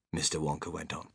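plain reading voice

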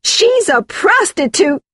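slow, very high furious accusation; hysterical, drawn-out cry; high-pitched outburst. Acted. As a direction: hurl the accusation at the top of your pitch. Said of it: "her voice reached a high point".

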